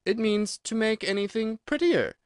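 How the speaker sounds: high, tentative definition; doubtful. A narrator's reading. Alice explaining to the Gryphon, high and doubtful. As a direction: offer it hesitantly. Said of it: "said Alice doubtfully".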